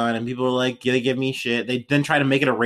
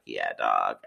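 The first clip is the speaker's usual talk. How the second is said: deep bro voice; 7 dB quieter than usual. Retold or imitated. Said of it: "And he was like".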